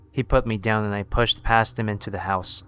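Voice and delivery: ordinary delivery, nothing marked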